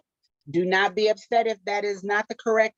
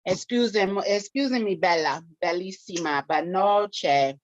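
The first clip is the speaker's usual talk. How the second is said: playful, fluent Italian delivery; Italian accent; 4 semitones lower than usual. Shown as a demonstration. Host demonstrating Italian for the quiz, Italian accent. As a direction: show off your Italian accent with flourish. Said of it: "Estrella said Italian".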